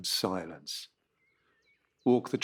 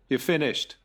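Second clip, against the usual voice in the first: curt, blunt verdict from the team boss; cold team boss; 4 semitones higher, 5 dB louder than usual. Acted. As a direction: deliver the sacking flat and final. Manager sacks the driver.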